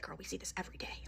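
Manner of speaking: hushed aside, all whisper; confidential whisper. Retold or imitated. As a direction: imitate a worker sharing gossip under her breath. Worker confiding while laughing, acted.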